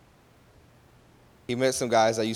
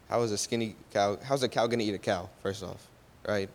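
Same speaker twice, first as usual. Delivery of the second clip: ordinary talk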